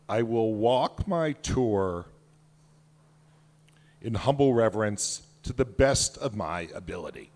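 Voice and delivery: measured, reverent reading of a creed; solemn recitation. Acted. Author reads the creed to the audience, solemn and measured. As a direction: read it like a sacred oath, steady and grave.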